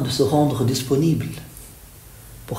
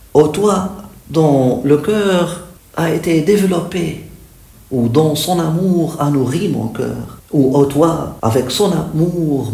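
fervent praise; 4 dB louder than usual. Acted. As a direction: lift each address to the beloved. Recites translated praise. (louange fervente et déclamée)

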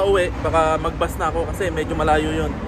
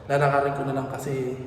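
ordinary talk

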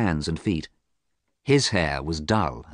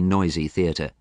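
ordinary delivery, nothing marked

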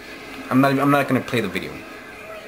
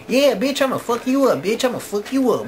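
way higher mocking gangster-girl taunt; 12 semitones higher than usual. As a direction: sneer it like a fake-tough wannabe.

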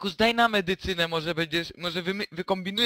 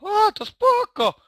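very high, loud, drawn-out relief; high, stretched-out cheer; gleeful relief; 13 semitones higher, 6 dB louder, slower than usual. Acted. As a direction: exclaim with exaggerated relief, pitch leaping up. Mock relief at five-minute symptoms.

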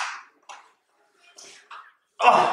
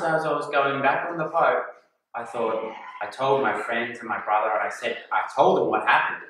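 ordinary talk

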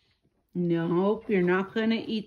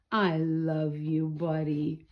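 slow, soft and lower; tender; 5 semitones lower, 4 dB quieter than usual. Real, not acted. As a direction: say it lovingly to your pet. Tender words to the pet.

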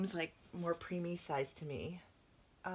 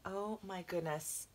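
ordinary delivery

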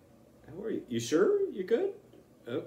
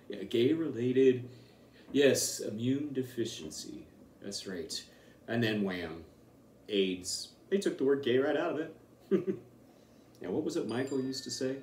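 usual speaking voice

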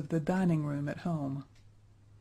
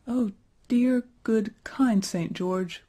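tearful plea; 5 semitones higher, 3 dB louder, slower than usual. A narrator's reading. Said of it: "Then Effie began to cry".

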